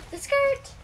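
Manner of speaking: high squeal of delight; excited gasp. Real, not acted. Excited reveal of skirt.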